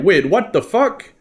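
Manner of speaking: high, startled what the; shocked players. Acted. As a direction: jolt up in alarm at an ambush. Players suddenly attacked by undead.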